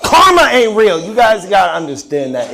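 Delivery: high, loud comic insistence, easing off; loud protest. Acted. Comic arguing with the shocked crowd, loud exasperated protest. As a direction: burst out, then settle down a little.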